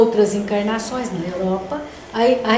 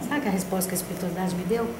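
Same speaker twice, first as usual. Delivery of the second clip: ordinary delivery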